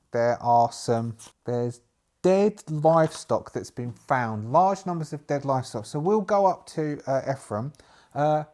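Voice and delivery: normal talking voice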